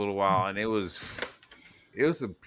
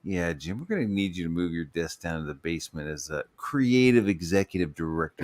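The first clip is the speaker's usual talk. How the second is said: office boss; 7 semitones lower than usual. Acted. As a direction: break bad news in a droning manager voice, pitched down. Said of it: "moving Jim Lee down into the fucking basement".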